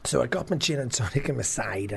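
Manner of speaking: ordinary talk, nothing marked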